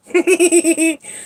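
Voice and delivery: very high, quick giggle; mischievous giggle. Real, not acted. Teasing the cat about dropping him, high giggle. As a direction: giggle high after a tease. Laughs after teasing lullaby.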